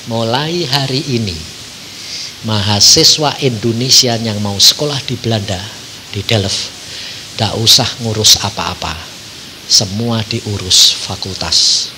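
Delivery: low, slow formal announcement; lightly voiced formal announcer; official announcement. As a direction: read it like a notice from the faculty.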